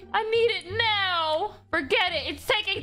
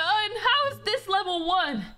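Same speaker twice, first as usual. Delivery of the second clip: usual speaking voice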